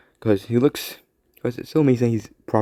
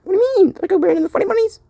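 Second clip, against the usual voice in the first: put-on much higher squeaky kid voice; Mini Spider-Man; 4 dB louder, faster than usual. Mini Spider-Man announcing himself to a friend, squeaky high. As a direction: squeak it out proudly. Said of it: "you talk very weird".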